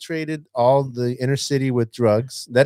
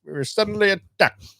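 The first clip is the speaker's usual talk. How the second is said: mock old-time president. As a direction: parody the old speech. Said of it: "sounds like Gregory Peck".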